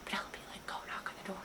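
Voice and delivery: hushed whisper, no voice at all; sneaking whisper. Retold or imitated. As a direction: whisper it so nobody behind the door hears. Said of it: "like, quietly. We're, like, sneaking up".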